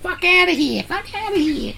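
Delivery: high squeaky mocking whine; mocking blah blah. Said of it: "have little gore cries".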